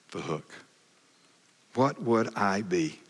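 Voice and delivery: ordinary talk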